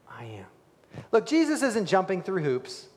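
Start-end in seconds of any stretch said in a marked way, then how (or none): 0.1-0.5 a soft reverent whisper. Real, not acted. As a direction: whisper "I am" in awe. Whispered divine name.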